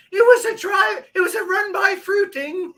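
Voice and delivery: high, loud squealed punchline; high yelped joke voice; mock shrill yell. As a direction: shriek it like a cartoon character.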